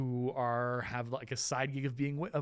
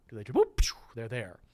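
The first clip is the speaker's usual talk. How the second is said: a sudden loud whoop, then pshoo; whoop sound effect; 8 dB louder, faster than usual. Shown as a demonstration. Teacher imitates witches suddenly appearing, loud whoop. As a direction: pop in with a whoosh noise. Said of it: "do they just appear".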